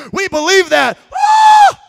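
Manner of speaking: loud joyful shout of belief; excited cry. Acted. Preacher voicing the crowd, a loud joyful shout.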